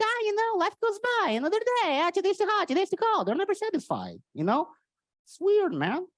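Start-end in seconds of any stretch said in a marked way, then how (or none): 0.0-4.2 high, whiny moaning voice; mock whiny complainer. Comic mocks never-satisfied Portuguese people, high whine. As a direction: whine it like nothing pleases you. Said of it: "This is like".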